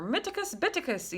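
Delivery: high-pitched pretend incantation; mock spell-casting. Waving a pretend magic wand, mock spell-casting. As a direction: cast a silly spell, pitch jumping up.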